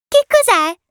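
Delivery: put-on high, loud child's question; curious little prince. A narrator's reading. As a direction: ask it in a piping child's voice. Little prince asks about the plane. (vocina acuta e curiosa)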